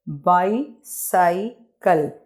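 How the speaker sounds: Bicycle slowly as bi, cy, cle; three spaced beats on bicycle; broken into syllables. Shown as a demonstration. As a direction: pause after each syllable of bicycle. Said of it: "इसको pronunciate कर लेते हैं".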